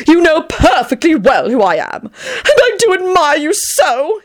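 high-pitched, hammed-up blubber. A narrator's reading. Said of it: "he blubbered".

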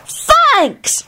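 very high squeal of thanks; child's thrilled shriek. A narrator's reading. Teddy thanks Kingsley for the gift, shrieking. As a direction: squeal it like a delighted little kid. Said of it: "Teddy shrieked".

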